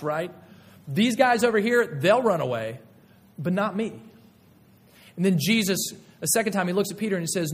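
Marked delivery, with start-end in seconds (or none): 0.9-4.0 louder, higher scorn for the others; boastful brush-off. Retold or imitated. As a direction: toss the other disciples aside proudly. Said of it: "casting his own friends under the bus".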